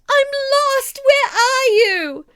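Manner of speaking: high, loud tearful cry; lost child sobbing for help; weeping cry. A narrator's reading. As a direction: cry out through tears. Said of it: "I was weeping and nearly blind".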